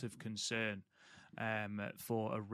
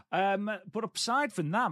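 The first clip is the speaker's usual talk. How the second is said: excited pivot; 9 semitones higher, 6 dB louder than usual. Real, not acted. Host pivots to greet co-host.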